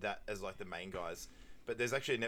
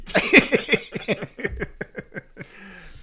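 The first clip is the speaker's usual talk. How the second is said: big burst of real laughter, high and loud; 10 semitones higher, 13 dB louder than usual. Real, not acted. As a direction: crack up hard at the joke. Laughing at his own retelling.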